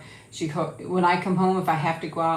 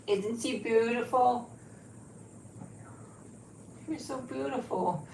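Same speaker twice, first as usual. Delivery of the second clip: tender cooing; 5 semitones higher, 7 dB quieter, slower than usual. Real, not acted. Owner cooing to her beautiful dog, slow and tender. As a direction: coo over her lovingly.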